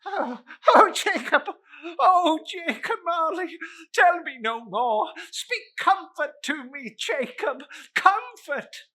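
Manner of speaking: high, loud pleading cries; desperate plea. Acted. Scrooge begs Jacob Marley for comfort, high and desperate. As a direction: beg for comfort.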